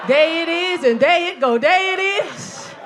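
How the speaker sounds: slow drawn-out "There it is" imitation; mock hype man. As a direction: mimic a guy cheering on nothing.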